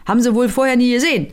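barking forester. Acted. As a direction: order the men off in a bark. Forester barks orders. (Förster kommandiert laut)

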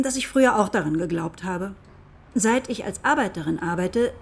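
usual speaking voice

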